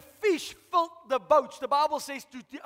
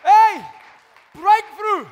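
excited shout; 8 semitones higher, 16 dB louder, much slower than usual. Acted. Peter calling to partners.

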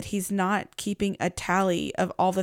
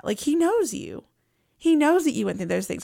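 usual speaking voice

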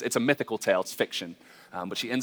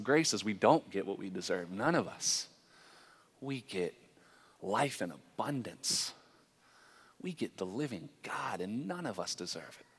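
hushed preaching; 5 semitones lower, 14 dB quieter than usual. Acted.

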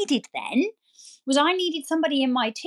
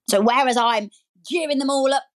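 ordinary delivery

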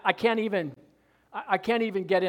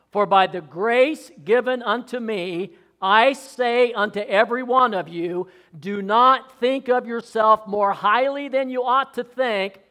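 preaching scripture; 3 semitones higher, 5 dB louder than usual. Acted. Scripture read with force.

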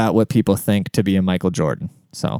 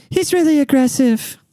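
high falsetto complaint; mock lady's falsetto; neighbor lady impression voice. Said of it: "the lady goes".

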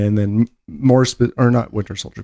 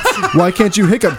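very high, laughing insistence; squealing demand; 9 semitones higher than usual. Pretend. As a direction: press him, cracking up. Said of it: "the aggressive tone".

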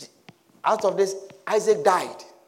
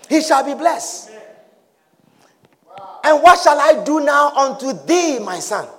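ordinary talk, nothing marked

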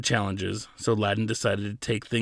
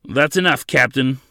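raised, commanding interruption; loud command; 3 semitones higher, 7 dB louder than usual. A narrator's reading. General halts the guard from the doorway, loudly commanding. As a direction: cut in loudly with authority. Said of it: "came a loud voice from the doorway".

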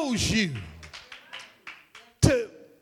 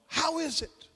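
"How is it" higher but softer; lightly emphatic "How is it", pitched up; emphatic question; 5 semitones higher, 6 dB quieter than usual. Shown as a demonstration. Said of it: "It's an emphatic in the Greek".

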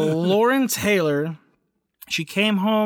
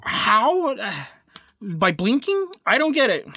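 baffled, high disbelief; 4 semitones higher than usual. Real, not acted. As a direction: pitch jumps up in disbelief. Baffled at the story.

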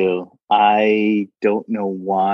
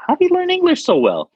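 much higher, fast fan voice; high-pitched fangirl squeal; squeaky amazed fan; 3 dB louder, faster than usual. Retold or imitated. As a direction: gush like an awestruck fan. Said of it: "they were like".

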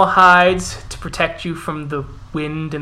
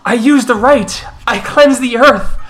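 higher, louder desperate defense; pleading bear shifter; 7 semitones higher, 4 dB louder than usual. Acted. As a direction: beg them to believe you. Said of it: "As the Gorel goes".

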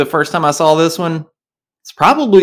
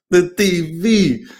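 mock Southern accent; 5 semitones higher, slower than usual. Savoring his own Southern say of T V, slow. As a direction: draw out T V like a lazy Southerner. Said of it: "my Southern accent".

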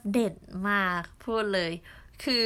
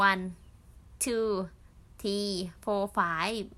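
counting one two three four five in a Thai accent, slow; much slower than usual. Shown as a demonstration. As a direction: say the numbers with a light Thai-English accent, drawn out. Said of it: "พูดเป็นสำเนียงภาษาไทยอะ". (นับเลขภาษาอังกฤษสำเนียงไทย ช้าๆ)

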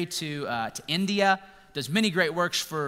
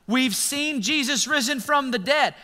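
excited disciples; 9 semitones higher, 4 dB louder, slower than usual. Retold or imitated. Buddies excitedly telling Thomas.

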